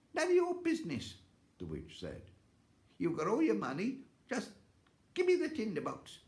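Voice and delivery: very high, snappish witch; shrill greedy old witch. Acted. Witch voiced in story.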